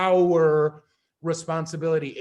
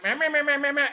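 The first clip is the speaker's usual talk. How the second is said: mock nagging; 7 semitones higher, faster than usual. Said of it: "your girl is going like".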